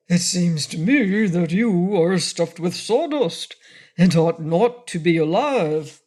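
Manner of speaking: a lightly voiced frogman. A narrator's reading. Frogman speaks.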